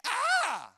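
very high, loud scream; imitated scream. Preacher imitating the frightened woman next to him, high scream. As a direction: burst out in a shrieked cry. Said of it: "she screamed again".

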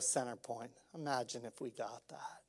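hushed whisper to the church. Real, not acted. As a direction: drop to a whisper, drawing them in. Hushed aside to congregation.

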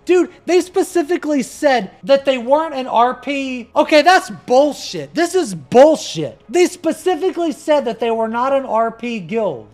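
loud, high indignant complaint; exasperated rant. Real, not acted. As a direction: call out the bullshit loudly. Indignant that the guild is RP.

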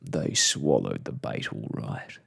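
low, gloating baddie voice; dark plotter's voice; grim villain. A narrator's reading. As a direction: say it low and grimly, pleased with the trap. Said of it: "laughed one of them grimly".

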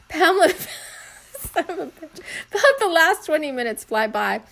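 laughing through the reading; can't hold back the giggles. Real, not acted. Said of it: "It's making me laugh".

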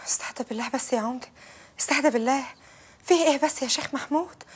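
quiet, anxious calming; soft pleading. Acted. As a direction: hush them down gently. Fatma pleading quietly to calm men.

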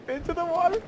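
very high, careful little voice; high-pitched, hopeful anticipation; tentative anticipation. Real, not acted. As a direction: edge in with a high, tense squeak. Tentatively trying the water.

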